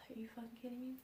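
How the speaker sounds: hushed, whispery dismay; retold reaction under the breath; whispered disbelief. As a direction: whisper it as if you can't believe it. Disbelief on being trapped.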